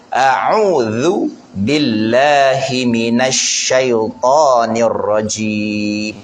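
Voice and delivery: "a'udzu billahi minasyaitonir rajim" very slowly, with a clear dh; slow recitation. Shown as a demonstration. Ustaz recites the ta'awuz for the congregation to repeat, slowly. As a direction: recite it slowly and carefully for copying. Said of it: "Cuba tuan sebut".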